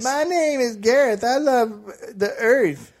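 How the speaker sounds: high, dopey mocking voice of a friend; Gareth impression. [name] mocks his co-host on the podcast with a high dopey voice. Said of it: "that was [name] doing an impression of me".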